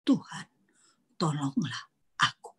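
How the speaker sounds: quick hushed whisper of "Tuhan, tolonglah aku"; Peter's short whispered cry for help; short plea. Retold or imitated. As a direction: breathe the plea softly and briefly. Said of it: "pendek sekali".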